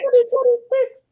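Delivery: very high cartoon mouse imitation; Mickey Mouse voice.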